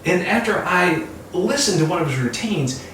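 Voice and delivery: ordinary talk, nothing marked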